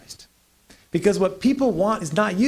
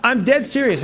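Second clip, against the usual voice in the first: laughing; 6 semitones higher, 6 dB louder than usual. Real, not acted. Laughs while insisting.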